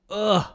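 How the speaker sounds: short high "ugh" of disgust; disgusted groan. Real, not acted. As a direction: let out a sharp, disgusted ugh. Disgust at slum tours.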